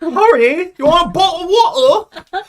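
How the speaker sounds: high, loud caricature of British speech; shrieky mock northern English accent; hammed-up British accent. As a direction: squawk it in over-the-top British. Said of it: "Hermione doesn't speak like that".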